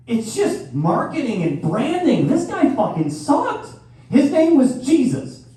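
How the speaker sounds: comic building outrage at Jesus' branding; mock outrage. Comic to audience, mock-indignant rant.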